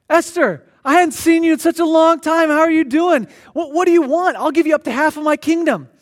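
king welcoming his queen, gushing and loud; delighted king. Acted. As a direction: greet her like an overjoyed king. King voiced greeting Esther delightedly.

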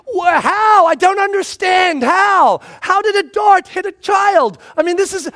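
put-on baffled dad questioning the boys; exasperated disbelief. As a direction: sputter in disbelief. Dad baffled by dart injury.

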